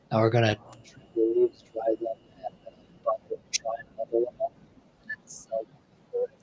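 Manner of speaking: underwater gurgling voice. Real, not acted. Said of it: "you sound like you're underwater again".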